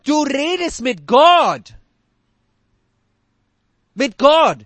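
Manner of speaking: "Gott" hit hard and loud, slowly; stress on Gott. Real, not acted. Preacher reminds listeners whom they address, punching "Gott". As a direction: lean on "Gott" with weight. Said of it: "preacher stresses Gott".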